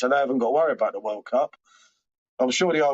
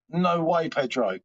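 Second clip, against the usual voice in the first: ordinary delivery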